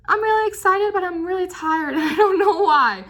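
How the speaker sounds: a worn-out but thrilled little voice breaking into a laugh; tired excited body voice. Pretend. The tired body whining to the viewer in a high squeak. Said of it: "Your three D body's like".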